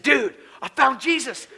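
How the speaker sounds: re-enacted excited outburst; excited announcement. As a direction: blurt it like thrilling news to a buddy. Telling his best friend the news.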